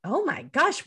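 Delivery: high-pitched, baffled mom reaction; clueless amazement. Retold or imitated. Mother retelling her own clueless reaction.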